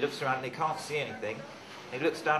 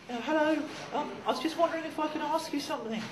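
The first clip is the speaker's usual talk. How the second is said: very high, small polite voice; tiny little snail. Acted. Snail asks the man at the door, tiny high voice. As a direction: squeak out a timid request. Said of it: "a tiny little snail".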